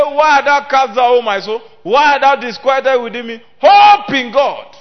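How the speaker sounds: loud, high pulpit cry; a preacher crying out the psalm, slowing on the last words; shouted preaching. Acted.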